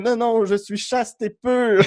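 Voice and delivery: high, slow mock-pious knight; prim Galahad, high and slow; mock chaste knight. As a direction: play the pure virgin knight, voice up and drawn out. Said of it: "puis lui, comme".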